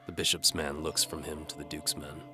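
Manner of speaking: normal talking voice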